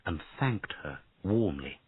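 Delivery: usual speaking voice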